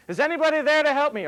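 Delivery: high, loud cry for help; yelling for help. Acted. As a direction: shout it down into the canyon, desperate. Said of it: "he's yelling out".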